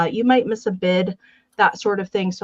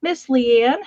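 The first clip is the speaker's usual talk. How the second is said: normal talking voice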